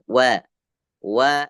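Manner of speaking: "Wa" with a light, open a; separate, carefully modelled "Wa"; thinned letter demo. Shown as a demonstration. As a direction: say "Wa" thin, not rounded to "wo". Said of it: "Bacanya ditipiskan".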